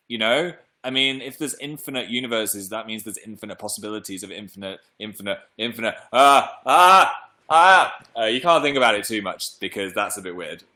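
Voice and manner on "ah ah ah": loud rising ah ah ah, brain overloaded; overwhelmed groan. Real, not acted. Thinking about infinite universes, loud overwhelmed ahs. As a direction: cry out as the thought overwhelms you.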